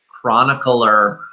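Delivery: ordinary delivery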